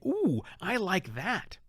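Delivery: ordinary talk, nothing marked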